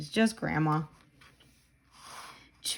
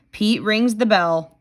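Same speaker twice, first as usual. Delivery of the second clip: ordinary delivery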